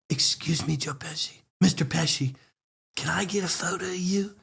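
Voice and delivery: soft, deferential approach; respectful request. Retold or imitated. Fan asking a movie star for a photo, softly respectful. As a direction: ask meekly, keeping the voice well down. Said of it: "went in the most respectful way you can".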